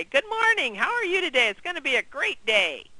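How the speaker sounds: cheerful greeting. Shown as a demonstration. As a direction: greet the agent like it's showtime. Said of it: "put a big smile on your face and say".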